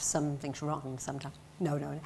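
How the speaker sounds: ordinary talk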